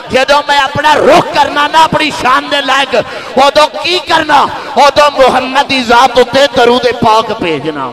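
impassioned Punjabi sermon building to a shout; building sermon climax. Acted. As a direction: preach it with mounting fervor up to a full cry. Preacher voicing God's words, building.

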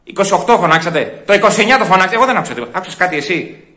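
ordinary talk

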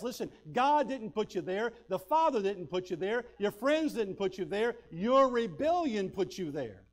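fervent preacher building to a slow, loud finish; full-force preaching. Acted. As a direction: hammer each line home to the congregation.

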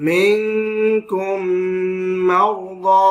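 "মিনকুম মারদা" slowly chanted with long nasal hums; ghunna on meem. Shown as a demonstration. Quran teacher demonstrating idgham, drawn-out nasal ghunna. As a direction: hum through the joined meems in "মিনকুম মারদা". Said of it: "মিম সাকিনে ইদগামের গুণনা".

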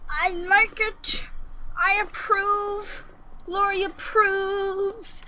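high, slow, drawn-out approval; playful approval. Real, not acted. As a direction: draw each word out high, pleased with your work. Approves of her own build.

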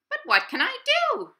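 very high, loud rabbit voice; Rabbit pleading. Acted. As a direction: squeak it out helplessly. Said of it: "replied Rabbit".

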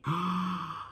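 sharp gasp of realization; shocked gasp. Retold or imitated. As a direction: gasp as it dawns on you. Realizing the tweet.

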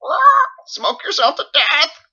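very high, very loud, slow squawking parrot; shrieking bird mimicking its owner; parrot screech. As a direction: blast it out like a screeching macaw. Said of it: "she blasted out".

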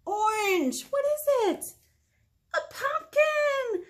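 high, loud storytime excitement; excited reveal. A narrator's reading. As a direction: thrill the children with the big reveal. Reader excitedly reveals the pumpkin.

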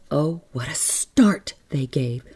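usual speaking voice

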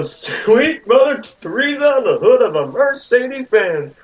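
high, swooping mock American accent. Friend quoting the abridged version to the group, exaggerated American accent. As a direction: put on an over-the-top, high-pitched American voice. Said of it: "He sounds like an American".

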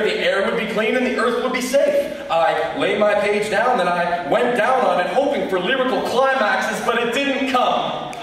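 usual speaking voice

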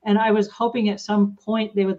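ordinary talk

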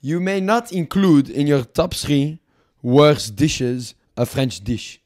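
emphatic mock French decree, softening at the end; put-on French accent. French envoy banning insults to France, French accent. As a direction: forbid it as a hammy French negotiator. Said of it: "Fuck this French accent".

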